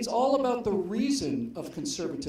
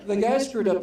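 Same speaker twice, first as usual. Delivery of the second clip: loud punchline; 2 semitones higher, 8 dB louder than usual. Real, not acted. Repeats punchline after laughter.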